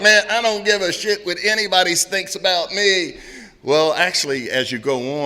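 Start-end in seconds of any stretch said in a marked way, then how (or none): 0.0-3.2 cocky newcomer bravado. Retold or imitated. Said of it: "I was like".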